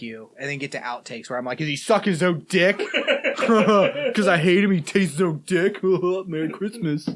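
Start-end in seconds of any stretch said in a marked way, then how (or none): 1.6-7.1 laughing. Real, not acted. Laughing, mock quote.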